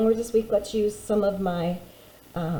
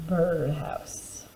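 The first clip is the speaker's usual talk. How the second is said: whispered word; 4 semitones lower, 8 dB quieter than usual. Shown as a demonstration. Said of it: "please write with me".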